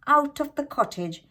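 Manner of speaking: ordinary delivery, nothing marked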